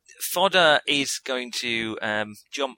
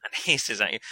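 ordinary talk

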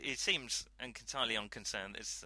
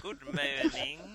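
put-on loud, high, drawn-out French-accented greeting; comedy French accent; 7 semitones higher, 6 dB louder, slower than usual. Player demonstrating the joke convention, comedy French accent. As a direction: sitcom Frenchman's English, pitched high and stretched out. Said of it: "you still speak English with a French accent".

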